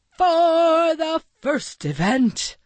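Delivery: high, drawn-out announcer's shout. A narrator's reading. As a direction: announce it to the whole crowd, slow and high. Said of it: "Maxwell shouted".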